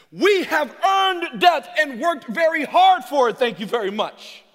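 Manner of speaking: ringing pulpit shout; full-force preaching. Acted. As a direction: preach it out over the room.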